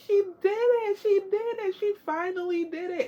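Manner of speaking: high, joyful mock scream; reenacted happy shriek, not full force; retold excited scream. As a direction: squeal it high, thrilled for a friend. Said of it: "I screamed and I said".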